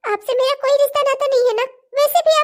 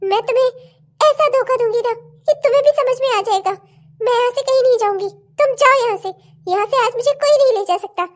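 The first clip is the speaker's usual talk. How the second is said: usual speaking voice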